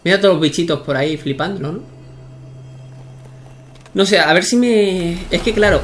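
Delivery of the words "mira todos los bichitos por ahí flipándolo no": normal talking voice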